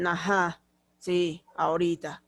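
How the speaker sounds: "Ajá, sí, ahorita" dropped low and reluctant; sulky, dragging "sí, ahorita"; grudging reply. Shown as a demonstration. Teacher showing a child answering mom reluctantly, low and grudging. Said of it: "Sí, pero es la entonación".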